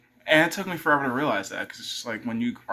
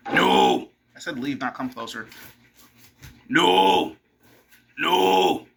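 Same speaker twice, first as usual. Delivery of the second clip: sharp scolding; 5 dB louder, slower than usual. Real, not acted. Scolding the dog.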